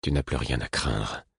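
calm him gently; reassuring. A narrator's reading. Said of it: "dis-je d'un ton rassurant".

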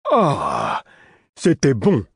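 high, delighted little man; halfling. Acted. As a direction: squeal it out with childlike joy.

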